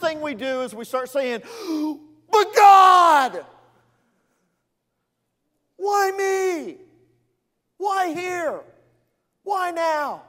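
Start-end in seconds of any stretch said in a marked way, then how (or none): 1.5-2.0 sudden panicked gasp; sharp gasp. Retold or imitated. Believer in a hard place gasping. 2.3-10.2 high, loud mock whine at God; whiny complaint. Believer in trouble whining to God.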